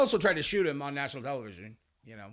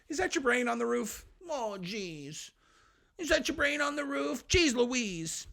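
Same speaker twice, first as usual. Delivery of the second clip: higher, hammed-up fake concern; mock sympathy; 9 semitones higher, slower than usual. The shooter mocking Trump in a high, hammed-up fake concern. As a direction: pretend to be shocked and sorry.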